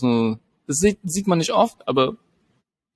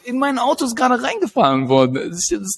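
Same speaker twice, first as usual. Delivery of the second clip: indignant outburst; 9 semitones higher than usual. Retold or imitated. Indignant reply to the officer.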